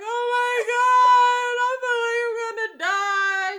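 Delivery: high, loud hammed-up wail of despair; mock dying wail. Calling her pastor, hamming up starving on a fast. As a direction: moan like you're starving to death. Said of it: "I'm like".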